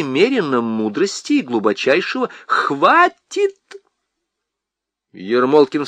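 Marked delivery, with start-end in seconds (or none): 2.5-3.6 a sudden, much higher cry to stop her; shrill cry. Acted. Husband stops wife reading, high panicked cry.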